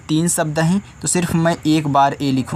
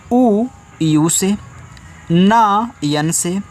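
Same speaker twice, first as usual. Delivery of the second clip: slow spelling dictation; 3 semitones higher, much slower than usual. Shown as a demonstration. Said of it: "teacher spells syllables for children".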